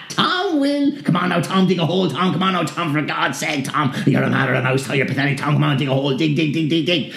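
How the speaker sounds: high, mocking goading; jeering demons. Demons goading Tom to dig, high and mocking. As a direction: taunt him to dig like pestering imps.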